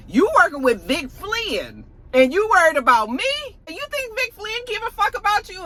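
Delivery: high-pitched mock indignation, climbing; incredulous retort. Acted retort in a quarrel.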